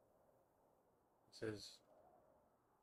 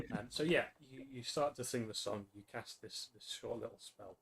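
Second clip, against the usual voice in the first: normal talking voice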